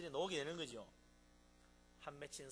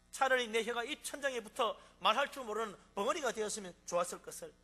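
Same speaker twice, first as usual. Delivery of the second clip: anguished lament; 5 semitones higher, 10 dB louder than usual. Acted. Psalmist's lament voiced by preacher.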